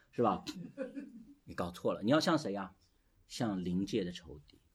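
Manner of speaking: ordinary talk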